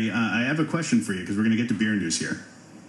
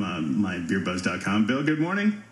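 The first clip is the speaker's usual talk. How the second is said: ordinary talk, nothing marked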